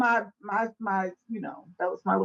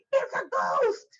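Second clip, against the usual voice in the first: high frightened cry; cried out in fear; slower than usual. Acted. Disciples seeing Jesus on the lake, frightened cry. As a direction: scream it like terrified sailors. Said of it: "cried out in fear".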